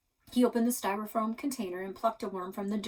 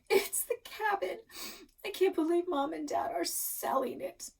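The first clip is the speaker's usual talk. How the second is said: higher, tearful sob through the words; sobbing; 9 semitones higher than usual. A narrator's reading. Amelia crying to her sister at night, sobbing. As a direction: let the sob break the line. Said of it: "she let out a sob".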